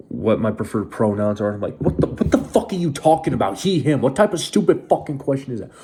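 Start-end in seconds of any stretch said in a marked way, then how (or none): 1.8-5.7 retold indignant outburst, pitched up; exasperated outburst. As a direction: throw it back in incredulous irritation. Retold outburst at being asked pronouns.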